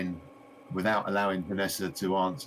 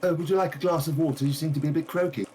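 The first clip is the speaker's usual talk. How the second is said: quick, higher fussing voice; fussing shopkeeper; 9 semitones higher, 4 dB louder, faster than usual. Acted. The shopkeeper hurrying to offer her guest water, high and quick.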